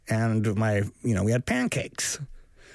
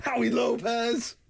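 much higher, slow sneer of the name; mocking kid; 8 semitones higher, slower than usual. Co-host sneering dad's mistaken band name, high and slow.

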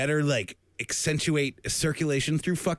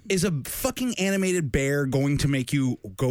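normal talking voice

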